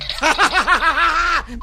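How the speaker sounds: high, loud burst of laughter; big laugh. Acted. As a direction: crack up at your own flub. Cracks up at own flub.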